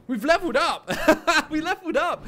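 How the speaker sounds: bitter laugh through the words; laughing in disbelief. Real, not acted. Gamer laughing at levelling up after dying. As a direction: laugh at the irony.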